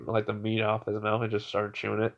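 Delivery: plain reading voice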